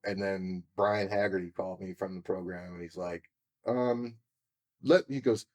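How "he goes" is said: ordinary talk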